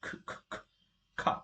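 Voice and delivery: bare, breathy puffs of k with no voice; repeated aspirated k, voiceless; pushed k sound. Shown as a demonstration. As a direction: push air behind each k. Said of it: "Push a little behind".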